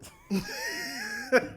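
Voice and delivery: very high, drawn-out cackle; real evil-sounding laugh. Real, not acted. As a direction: let out a wicked high cackle. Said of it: "That evil laugh is crazy".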